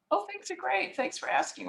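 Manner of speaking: high, light brush-off pleasantry; superficial small talk. Acted. Person answering a casual what's going on, superficial. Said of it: "just a very superficial".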